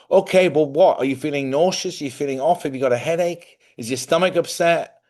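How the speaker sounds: retold exasperation, demanding a straight answer; exasperated questioning. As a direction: reel off questions in mock frustration. Retold exasperated questioning of his wife.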